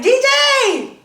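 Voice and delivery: name yelled out high and loud; playful call. Retold or imitated. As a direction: call across the room in mock alarm. Calling friend's name playfully.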